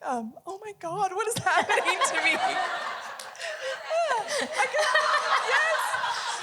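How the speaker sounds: surprised at own tears, half laughing; teary laugh. Real, not acted. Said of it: "I'm getting a little emotional".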